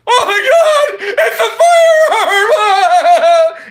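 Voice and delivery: very high, very loud, slow laughing shriek; shrieking mock panic. Mocking a YouTube reviewer seeing a gun, hammed-up shriek. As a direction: scream it like a panicked censor. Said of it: "clicks on it and goes".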